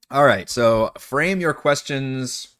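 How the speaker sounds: slow, deliberate, loud. Real, not acted. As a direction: spell it out slowly and loudly. GM instructs the players.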